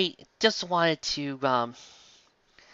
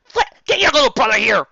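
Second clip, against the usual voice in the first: loud, higher, half-crying yell; urgent shout; 8 semitones higher, 7 dB louder than usual. Acted. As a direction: bark the order in a panic. Shouting order, crying sound.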